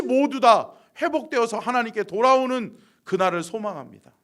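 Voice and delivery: loud, impassioned pulpit delivery; full-force preaching. Real, not acted. Pastor urging scattered congregation back to worship, fervent preaching. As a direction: proclaim it at full voice.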